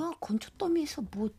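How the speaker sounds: mocking whisper to her friend. Schoolgirl to friend about crier, mocking whisper. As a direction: hiss it under breath, snickering.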